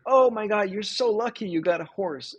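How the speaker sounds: gushing neighbors. Retold or imitated.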